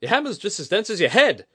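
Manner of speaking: high, loud angry retort; snapped. A narrator's reading. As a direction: bite the insult off sharply. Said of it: "Kudaka snapped".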